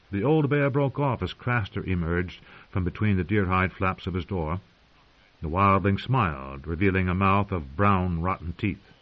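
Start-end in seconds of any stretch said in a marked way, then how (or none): none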